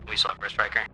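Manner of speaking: usual speaking voice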